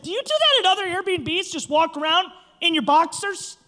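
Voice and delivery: high, loud host telling off a guest; indignant retold telling-off; imitated scolding. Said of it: "she's, like, scolding me".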